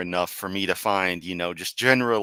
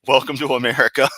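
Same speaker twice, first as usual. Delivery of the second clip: laughing remark; 5 semitones higher, 8 dB louder, faster than usual. Real, not acted. Laughing aside during sad letter.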